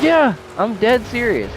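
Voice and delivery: insisting hard; earnest insisting. Retold or imitated.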